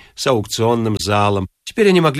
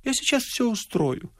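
soft, high, gentle voice; small meek female voice; 9 semitones higher, 8 dB quieter than usual. A narrator's reading. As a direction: agree demurely and softly. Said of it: "сказала барышня кротко".

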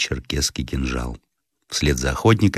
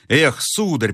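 higher, lightly voiced protest; exasperated servant; 9 semitones higher, slower than usual. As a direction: cut in as an impatient servant. Servant interrupts the master. (слуга досадливо перебивает)

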